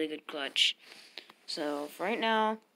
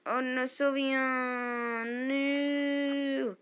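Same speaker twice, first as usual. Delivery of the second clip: high, long drawn-out cry; stretched, high-pitched lament; mock-despairing wail; 6 semitones higher, much slower than usual. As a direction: wail it out in melodramatic grief. Losing Sylveon in battle.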